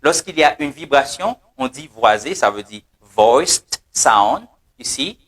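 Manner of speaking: ordinary talk, nothing marked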